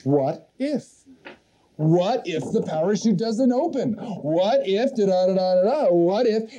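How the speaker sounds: mock chatter of a worrying mind; nagging inner voice. Speaker replays the fearful thoughts for the audience, nagging inner voice. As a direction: run the what-ifs together like looping worry. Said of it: "you will discover this little voice".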